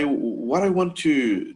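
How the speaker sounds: ordinary talk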